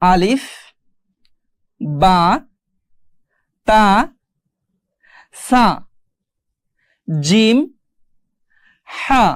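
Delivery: Arabic letter names read out slowly, one at a time; teacher's careful, spaced-out alphabet recitation; letter-by-letter demonstration. Shown as a demonstration. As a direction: model each letter for learners, leaving long pauses. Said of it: "আমি আপনাদের তবুও পড়িয়ে দেই".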